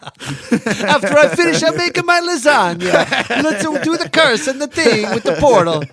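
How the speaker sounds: put-on a silly Italian cook, Italian accent; mock Italian accent. Co-host riffing on the German guy, Italian accent. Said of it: "mocking the German guy".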